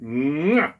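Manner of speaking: a smacking kiss sound with a rising lilt; chef's kiss. Pretend. Cook listing the holiday menu, giving a chef's kiss. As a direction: blow a quick kiss of delight.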